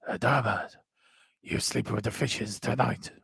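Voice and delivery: a hint of a menacing Italian Godfather threat. [name] ringing Adama after a mistake, in a light Italian Godfather voice. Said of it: "in his Italian Godfather voice".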